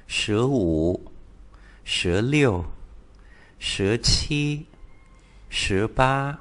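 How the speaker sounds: teacher modelling the Chinese numbers eleven to twenty; Mandarin numbers. Shown as a demonstration. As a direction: read the Mandarin teens with clear tones. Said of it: "tập ghép các cái số vào".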